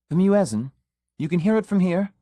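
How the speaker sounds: sudden surprise at a faint sound; surprised question. A narrator's reading. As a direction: caught off guard by the distant call. Surprised she can hear prayer call.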